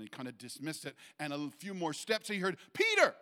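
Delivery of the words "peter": very high, full shout of the name; booming audible call. Retold or imitated. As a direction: cry out the name far louder than talk. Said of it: "it was so profound and audible".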